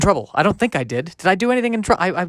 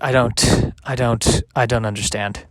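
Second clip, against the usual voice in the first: normal talking voice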